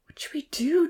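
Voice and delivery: high, breathless scared whisper; frightened whisper. Acted. Tina asking Uncle Louis in a frightened whisper. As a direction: whisper it, terrified.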